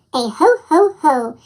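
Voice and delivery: usual speaking voice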